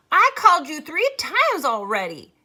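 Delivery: high, loud mocking sister voice; prissy imitation. Maybelle mocking Leslie to Jess, prissy and loud. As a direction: shout it like a prissy little girl. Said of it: "Maybelle was imitating Leslie's prissiest manner".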